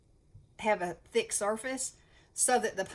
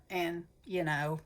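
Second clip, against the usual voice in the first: normal talking voice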